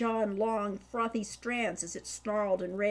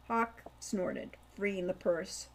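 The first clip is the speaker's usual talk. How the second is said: ordinary delivery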